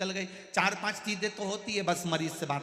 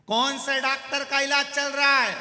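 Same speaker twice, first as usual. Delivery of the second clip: loud question; 10 semitones higher, 12 dB louder than usual. Retold or imitated. बहरा आदमी ज़ोर से पूछता है.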